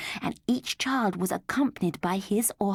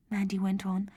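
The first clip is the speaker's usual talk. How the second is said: usual speaking voice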